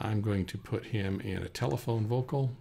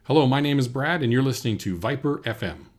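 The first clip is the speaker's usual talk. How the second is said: loud, raised radio ident; radio host; 5 semitones higher, 6 dB louder than usual. Pretend. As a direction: project it like a station announcer. Another take of station ID.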